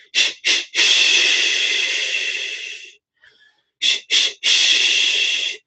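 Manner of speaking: short shushes, then a long one; calming shush. Shown as a demonstration. Demonstrating the sound that calms a crying baby, rhythmic shushing. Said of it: "to calm the baby down to use the".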